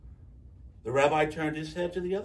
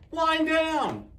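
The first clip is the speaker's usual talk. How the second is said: high, loud shriek; 14 semitones higher, 5 dB louder than usual. A narrator's reading. The other side shrieking back, high and loud. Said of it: "The other side shrieked".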